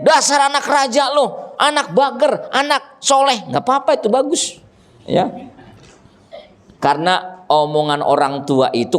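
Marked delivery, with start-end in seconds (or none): 0.0-3.5 mock scolding. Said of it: "pengen marahin anak, omelin".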